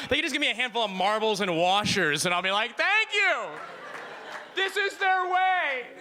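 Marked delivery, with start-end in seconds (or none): 2.8-5.9 high voice, slow and meekly accepting; meek tourist. Retold or imitated. Tourist meekly thanking the cashier, high slow voice. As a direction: take the marbles politely, without a clue.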